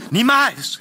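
high, loud cry of refusal; wife's outcry. A narrator's reading. Wife refusing the stranger's claim, high loud outcry. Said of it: "rief meine Frau".

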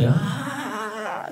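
long, high mock wail; drawn-out acted sob, pitched high; imitated crying. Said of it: "הוא בוכה".

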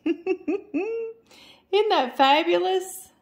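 delighted laugh through words. Real, not acted. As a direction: gush with a laugh. Laughing at Chicago river.

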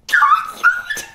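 very high loud squeal; delighted shriek. Real, not acted. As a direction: shriek it with joy. Sees favorite villager.

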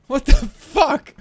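loud, high, drawn-out disbelief; stunned and grossed-out outburst; appalled shock. Real, not acted. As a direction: cry out in real horrified shock. Disgusted shock at the sound.

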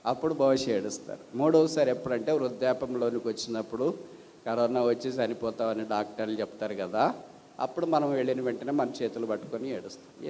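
normal talking voice

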